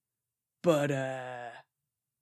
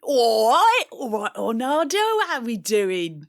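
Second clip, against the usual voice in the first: loud, higher mock Cockney greeting; light Cockney hello; hammed-up Cockney; 6 semitones higher, 7 dB louder than usual. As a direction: play the cheeky East End geezer. Cockney character greets mate.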